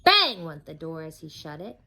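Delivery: plain reading voice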